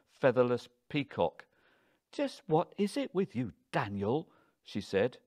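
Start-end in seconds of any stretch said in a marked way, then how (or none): 2.1-4.3 high-pitched, affronted challenge; indignant wife demanding an answer; exasperated. A narrator's reading. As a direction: confront your husband, voice pitched right up. Wife snaps at insulting husband.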